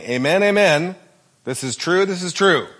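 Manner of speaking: amen, amen said high and loud, each this is true pressed hard; a preacher's urgent attention call; emphatic declaration. Shown as a demonstration. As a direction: proclaim it so nobody misses it. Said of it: "You need to pay attention to this".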